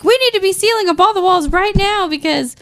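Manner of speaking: high-pitched insistent interruption; imitated urgent merchant. Merchant cutting into the argument, mock urgency.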